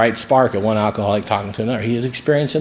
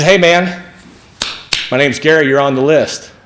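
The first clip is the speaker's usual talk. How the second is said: louder, higher upbeat greeting; hearty greeting; 5 semitones higher, 3 dB louder than usual. Retold or imitated. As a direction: greet a stranger briskly as Gary would. Gary greets him at the door.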